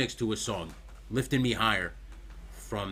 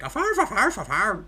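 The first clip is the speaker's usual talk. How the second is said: high, lilting fake Irish; exaggerated up-and-down Irish lilt; bad Irish accent; 9 semitones higher than usual. Shown as a demonstration. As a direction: do a badly faked Irish accent that jumps in pitch. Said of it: "I can't do Irish. I'm really bad at Irish".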